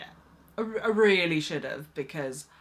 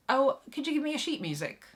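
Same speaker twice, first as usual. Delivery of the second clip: breezy clipboard lady; 6 semitones higher, faster than usual. Retold or imitated. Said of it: "and she goes".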